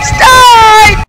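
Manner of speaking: high terrified scream. Real, not acted. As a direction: scream it in terror. Caught by monster.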